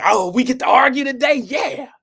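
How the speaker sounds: loud, high mock eagerness; mimicking argumentative sis. Streamer plays sis keen to argue, mock excited. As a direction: imitate her relishing a fight. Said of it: "Y'all know she's cynical".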